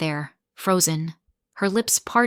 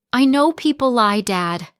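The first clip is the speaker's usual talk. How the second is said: higher, louder desperate plea; pleading; 5 semitones higher, 5 dB louder than usual. A narrator's reading. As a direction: beg him to hear it. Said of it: "I pleaded".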